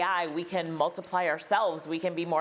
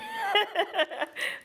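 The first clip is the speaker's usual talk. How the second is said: a high, delighted laugh; 13 semitones higher than usual. Real, not acted. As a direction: laugh at his boast. Host laughs at push-up boast.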